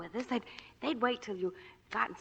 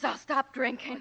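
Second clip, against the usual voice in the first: ordinary talk, nothing marked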